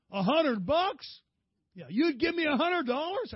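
high, loud, drawn-out disbelief as the son; a boy who can't believe his luck, voice way up high; excited kid. Retold or imitated. Son hearing dad offer a hundred dollars, excited high squeal.

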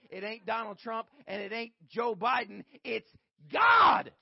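slow, shouted, high preaching climax; preaching at full force. Acted. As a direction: roar the line to the church. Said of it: "that was the line".

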